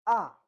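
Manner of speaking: AH short and sharply falling; fourth tone. Shown as a demonstration. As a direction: drop AH fast from the top. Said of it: "And the fourth one is".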